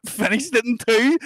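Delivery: slowed, giggling punchline; laughing through it. Retold or imitated. Telling his mate how Sammy finished, laughing. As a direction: let the laugh break the words.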